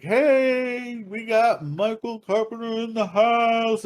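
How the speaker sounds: high, drawn-out club DJ hype; DJ announcing. Retold or imitated. DJ introducing the birthday guy to the club, hyped announcement. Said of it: "D J starts announcing".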